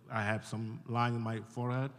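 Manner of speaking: ordinary talk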